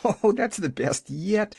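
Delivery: high, crowing glee; little girl crowing at the joke; crowing delight. A narrator's reading. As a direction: crow with delight. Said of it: "she crowed".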